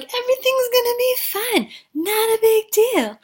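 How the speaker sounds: high, breezy falsetto reassurance; mock boyfriend. Boyfriend telling girlfriend it's fine, high mock voice. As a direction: brush off the crisis in a high, airy voice. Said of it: "He's like".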